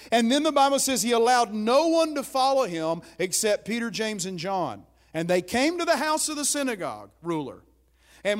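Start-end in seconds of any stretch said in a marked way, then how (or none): none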